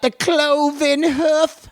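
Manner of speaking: high, drawn-out mock British rocker; put-on voice. Reading a sponsor's pedal name, silly high put-on voice. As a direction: stretch it out in a high character voice. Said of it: "my voice goes to that one".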